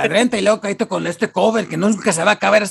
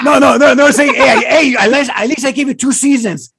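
Netflix boss; 8 semitones higher, 4 dB louder than usual. Acted.

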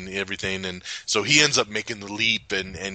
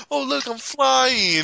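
high, slow, drawn-out marvelling; mock wonder; 14 semitones higher, slower than usual. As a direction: marvel like you're blissfully airborne. Voicing blissful character who is high.

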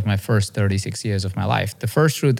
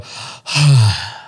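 heavy out-of-breath puff; big acted gasp for breath; winded exhale; 3 semitones higher, 4 dB louder than usual. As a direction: blow out hard, acting winded from running. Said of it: "I'm out of breath".

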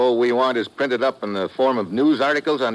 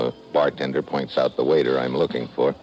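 tough agent narrating over music; hard-boiled narration; 6 semitones lower than usual. Acted. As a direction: set up the story, world-weary.

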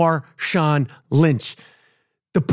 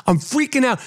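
normal talking voice

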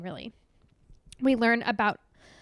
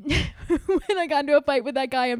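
laughing; 4 semitones higher, 4 dB louder than usual. Real, not acted. Laughing at her own fight.

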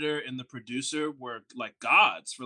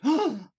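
sharp mock gasp, pitched up; shocked gasp; 11 semitones higher, 5 dB louder than usual. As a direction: gasp as if wrongly picked out. Bucky's shocked reaction acted out.

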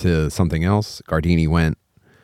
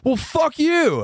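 high-pitched retold comeback; acted indignant retort; mock defiance; slower than usual. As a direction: throw back a hammed-up insult, pitch way up. Retold heckler snaps back at father.

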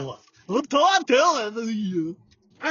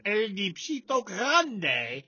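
mock Spanish; 3 dB quieter, much slower than usual. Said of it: "Spanish, by the way".

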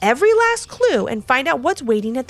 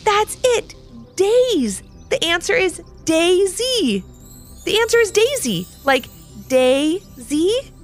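excited; 9 semitones higher, slower than usual. Acted. Boy solves the riddle.

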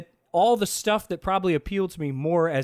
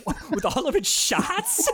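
high, slow, laughing question; laughing mock confusion; laughing disbelief; 13 semitones higher than usual. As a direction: squeak it out through laughter. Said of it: "He's like".

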